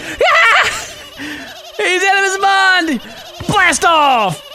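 very high whooping cheer; wildly excited. Real, not acted. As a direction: shriek it, stretching the words. Cheering the game's wild launch.

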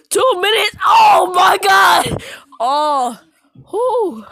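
real high, loud gasping shout; panting outburst after a long verse; breathless exclaim. Real, not acted. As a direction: blurt it out, winded and stunned.

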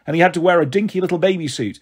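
ordinary delivery, nothing marked

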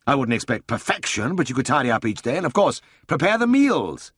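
high, lively voice for the bustling boss; brisk Siegfried. A narrator's reading. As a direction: play the eager older brother full of a plan.

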